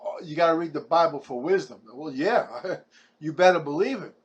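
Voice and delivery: ordinary talk, nothing marked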